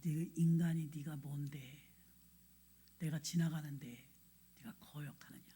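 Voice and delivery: a hushed, solemn divine rebuke; voice of God. Retold or imitated. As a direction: speak as a stern God rebuking inwardly. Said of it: "그 성령께서 제 마음에".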